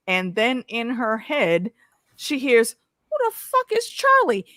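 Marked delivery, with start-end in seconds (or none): 3.1-4.4 very high-pitched mock indignant demand; ad-libbed outrage. Host ad-libbing a character questioning her about Charlie, mock outraged. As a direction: exaggerate his jealous questioning, pitched way up. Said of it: "he didn't say it like that".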